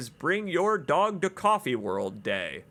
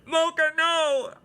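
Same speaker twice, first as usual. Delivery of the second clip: high, anguished outcry; dismayed cry; 11 semitones higher than usual. Real, not acted. Outrage at the dead moose.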